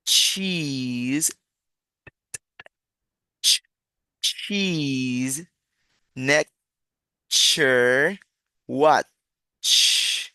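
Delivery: "cheese, nature, watch" drawn out, CH stressed; slow teacher's model of "cheese"; articulated CH sound. Shown as a demonstration. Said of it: "Entonces pronunciamos".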